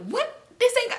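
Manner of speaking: very high mock disbelief; incredulous. Answering grandma's accusation about that boy, high incredulous squeak.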